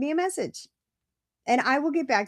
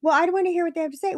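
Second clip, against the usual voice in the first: mimicked mother-in-law; 6 semitones higher, 4 dB louder, much faster than usual. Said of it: "she'll be like".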